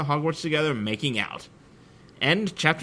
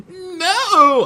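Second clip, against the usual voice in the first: high, loud frightened cry; scared shout; 9 dB louder than usual. A narrator's reading. Ebony cries out as the horrible man flies at her, scared shout. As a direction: shriek it in fright. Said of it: "I shouted in a scared voice".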